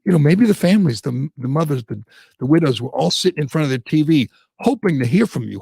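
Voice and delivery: ordinary talk, nothing marked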